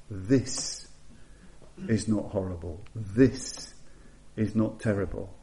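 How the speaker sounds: quiet reassurance, lightly voiced; Buddha's calm words. Preacher quotes the Buddha soothing Yasha, gently understated. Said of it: "where the Buddha just says".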